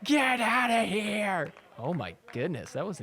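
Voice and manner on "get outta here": high, drawn-out mock yell; imitated scream. Commentator mimicking player screaming in opponent's face, mock yell. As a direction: stretch each word in an imitated holler. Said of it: "screaming in his face".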